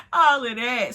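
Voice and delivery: ordinary delivery, nothing marked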